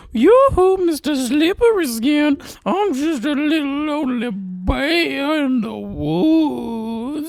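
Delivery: high, slow, whiny lure; lonely bear call. Pretend. Governor in bear suit luring the bear, high whiny coo. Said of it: "and I'm gonna go".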